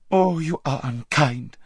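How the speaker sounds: high, slow, wounded whine; whining reproach. A narrator's reading. Lazareline reproaching her friend, whining. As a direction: draw it out pitifully.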